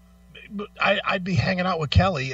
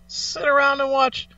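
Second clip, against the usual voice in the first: mock sales pitch; 9 semitones higher, slower than usual. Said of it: "sarcastic recommendation".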